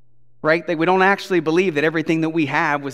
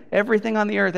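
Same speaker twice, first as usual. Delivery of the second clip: ordinary delivery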